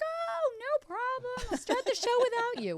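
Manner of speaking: very high, singsong mock voice; imitating breezy colleague. Colleague replying to the late driver, mock breezy and high. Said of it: "she's like".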